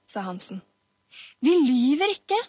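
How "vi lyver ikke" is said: high, loud denial; indignant high-pitched cry; children's protesting shout. A narrator's reading. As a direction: protest loudly like an accused child. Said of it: "ropte Hansen-barna i munnen på hverandre".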